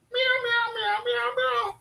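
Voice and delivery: very high, thin meow meow calls to a cat; squeaky imitation meows. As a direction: meow like a kitten to lure the cat out. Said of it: "now I'm doing the Caesar".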